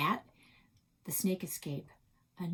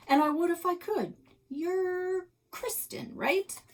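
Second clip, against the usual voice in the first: sweet-talking adult, pitched up; higher realtor voice; 12 semitones higher, 4 dB louder than usual. Acted.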